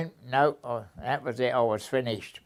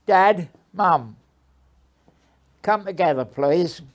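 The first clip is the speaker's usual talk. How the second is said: higher and louder call into the back; 4 semitones higher, 4 dB louder than usual. Retold or imitated. Elsie calls her parents out of the bakehouse, raised call.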